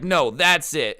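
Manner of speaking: loud retold challenge; imitated angry boyfriend. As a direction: act out someone squaring up for a fight. Acted quote, boyfriend starting a fight.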